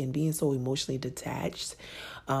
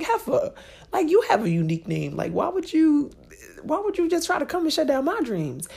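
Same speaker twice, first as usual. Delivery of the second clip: much higher, hammed-up indignant scold; mock outrage at friend; 13 semitones higher, 5 dB louder than usual. Arguing with her girlfriend over the baby name, hammed-up indignant scold. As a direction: playfully tell off your friend. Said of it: "And I'm like".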